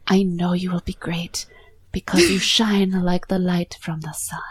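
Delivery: put-on whispery, breathy Italian accent; Paolo's bad Italian accent. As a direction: murmur it like a hammy Italian charmer. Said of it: "This is even worse than Paolo's".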